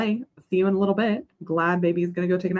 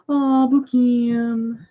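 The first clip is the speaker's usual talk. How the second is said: high, slow coo at a pet; high drawn-out aww; 7 semitones higher, 4 dB louder, much slower than usual. Real, not acted. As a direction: melt over the dog, drawn out. Cooing at the dog.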